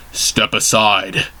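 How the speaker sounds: low, slow commanding voice; a deep voice orders them aside. Acted. Harambe orders the heroes aside in a low, slow voice. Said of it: "came a deep voice".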